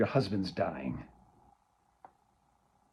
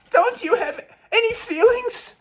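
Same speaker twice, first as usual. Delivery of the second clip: much higher, loud tearful cry; crying plea; 10 dB louder than usual. Acted. Daughter crying at her cold mother, tearful. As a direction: break into tears on it.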